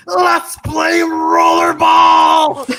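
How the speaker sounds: very slow, high arena announcer shout; announcer shout Paul Heyman. Retold or imitated. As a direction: stretch it out like a ring announcer. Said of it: "all they remember him saying".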